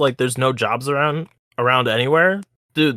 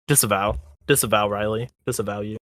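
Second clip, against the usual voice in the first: much lower and softer, intoning; mock solemn denunciation; 7 semitones lower, 8 dB quieter than usual. Co-host jokingly demanding Riley disavow, in a low mock intone. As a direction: pronounce it like a grave ritual curse.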